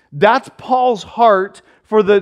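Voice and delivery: ordinary talk, nothing marked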